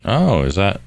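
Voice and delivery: normal talking voice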